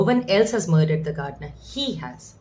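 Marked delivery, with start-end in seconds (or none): 1.7-2.3 "He" carries the weight, "has" falls away; stress on He. Shown as a demonstration. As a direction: point the finger with "He". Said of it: "now we will stress on he".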